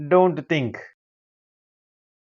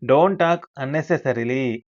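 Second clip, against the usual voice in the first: slow model sentence; much slower than usual. Shown as a demonstration. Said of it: "అలాంటి సందర్భాలలో".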